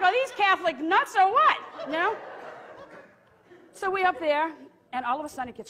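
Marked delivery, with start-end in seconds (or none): 0.0-1.6 high, loud incredulous outburst; mock exasperation. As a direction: cry out in comic disbelief.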